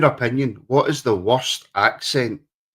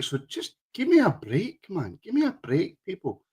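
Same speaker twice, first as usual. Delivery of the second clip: high, soft mock plea; pleading; 7 semitones higher, 6 dB quieter than usual. Real, not acted. Host late after fixing his hair, pleading. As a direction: plead for some slack, pitched high and light.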